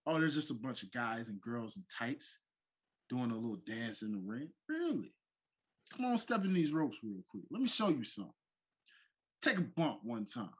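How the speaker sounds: taunting heel promo; wrestling promo. Pretend. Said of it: "bring this in segment style".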